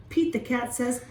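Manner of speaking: plain reading voice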